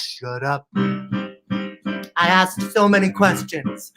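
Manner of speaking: ordinary talk, nothing marked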